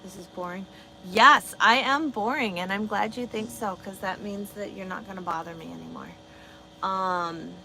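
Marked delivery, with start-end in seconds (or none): 1.1-2.6 sarcastic. Real, not acted. Sarcastic reply to bored viewer.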